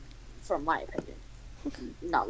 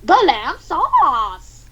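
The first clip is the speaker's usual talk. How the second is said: high, loud, long-drawn character voice; slow, dramatic high voice; Japanese man; 5 semitones higher, 5 dB louder, much slower than usual. Acted. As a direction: stretch every word, high and loud. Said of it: "Japanese man says what".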